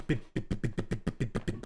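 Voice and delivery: ordinary talk, nothing marked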